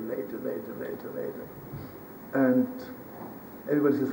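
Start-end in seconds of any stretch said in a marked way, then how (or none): 0.0-0.7 softer, letting the words fall away; trailing off. A narrator's reading. Narrator stretching the waiting before Yom Kippur, fading softly. Building suspense of late hour. 0.8-1.5 barely voiced whisper. A narrator's reading. Narrator stretching the waiting before Yom Kippur, whispered. Building suspense of late hour.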